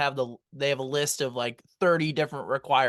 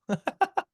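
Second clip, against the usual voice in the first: laugh. Real, not acted. Eating very sour candy.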